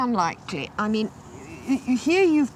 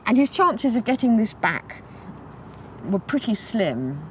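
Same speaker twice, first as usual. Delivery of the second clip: ordinary talk, nothing marked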